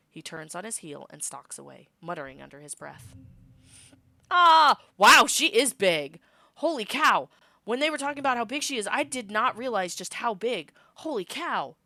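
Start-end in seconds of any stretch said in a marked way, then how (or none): 4.3-11.8 loud, high gasping amazement; amazed excitement. Real, not acted. The reader reacts to the picture of Socks, loudly amazed. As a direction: react like seeing her huge for the first time. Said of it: "reader gasps at the art".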